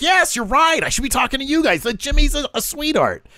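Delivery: high, excited mock agreement; eager agreement. Underling agreeing with his boss, high and eager. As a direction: gush it way up high, imitating a flatterer.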